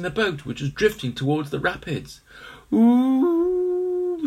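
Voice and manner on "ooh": high delighted ooh. A narrator's reading. Henry seeing the steamboat, delighted. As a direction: coo it up high. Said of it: "said Henry".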